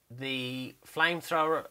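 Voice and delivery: normal talking voice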